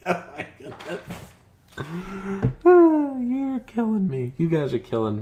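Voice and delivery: cracking up mid-sentence; laughing hard. Real, not acted. Cracking up at a comment.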